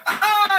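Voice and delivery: high, loud scream; imitated battle scream. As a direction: let out a wild charging yell. Imitates Burma screaming on the charge.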